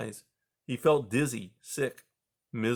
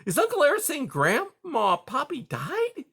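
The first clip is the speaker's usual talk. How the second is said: put-on little boy whisper. A narrator's reading. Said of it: "he whispered".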